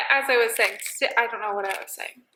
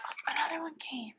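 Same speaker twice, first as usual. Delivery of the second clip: whisper; 3 semitones higher, 14 dB quieter than usual. Real, not acted. Quietly watching birds at feeder.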